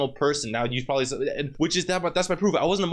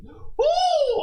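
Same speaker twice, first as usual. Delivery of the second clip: high-pitched venting "woo"; exasperated whoop; 4 dB louder than usual. Real, not acted. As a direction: blow off anger in one high whoop. Venting remembered anger.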